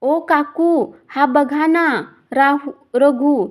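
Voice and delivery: put-on girl in the boy's grip calling mother and aunt; whimpering cry for help. A narrator's reading. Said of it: "विवळत Reva ओरडली".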